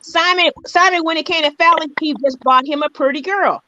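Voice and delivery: usual speaking voice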